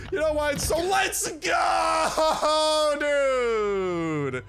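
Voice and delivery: high, drawn-out celebratory yell; over-the-top excited scream; hype shout. Pretend. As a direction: break into a long triumphant holler. Bursts into celebration mid-sentence.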